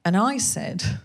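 ordinary talk, nothing marked

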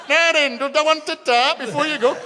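a cheeky uncle setting up his prank; joker uncle. Retold or imitated. Uncle offering dad a fake tart, high and cheeky.

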